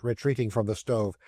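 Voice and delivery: ordinary delivery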